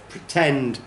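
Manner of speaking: pretend, slowly, stress on TEND; second-syllable stress. Shown as a demonstration.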